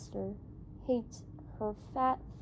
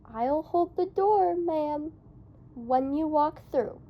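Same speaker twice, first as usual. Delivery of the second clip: grand, higher and louder courtly offer; ceremonious; 4 semitones higher, 5 dB louder than usual. A narrator's reading. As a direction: play the gallant doorman with a flourish.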